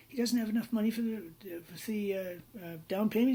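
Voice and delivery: lightly voiced Australian, high-pitched; Australian fellow. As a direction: imitate an Aussie businessman thinking aloud. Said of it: "the Australian fellow".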